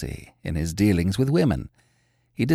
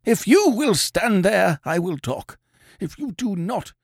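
furious anger; 8 semitones higher than usual. A narrator's reading. Sick man repelling his advancing friend, angry. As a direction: set terms with tight anger. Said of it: "with a look of furious anger".

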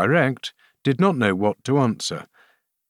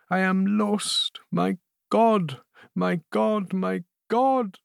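hushed, anguished lament; the queen breaking under dread; despairing queen; 9 semitones higher than usual. A narrator's reading. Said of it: "murmured the queen".

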